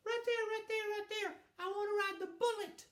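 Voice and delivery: little brother. Retold or imitated. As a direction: pipe up like an eager six-year-old. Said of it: "and he's going".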